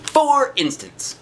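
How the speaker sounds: high, slow sing-song for instance; drawn-out singsong aside to the listening kids; playful refrain. A narrator's reading. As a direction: stretch it out up high like a teasing refrain.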